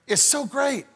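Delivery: usual speaking voice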